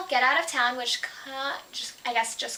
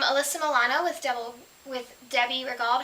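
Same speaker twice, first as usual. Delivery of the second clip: ordinary talk, nothing marked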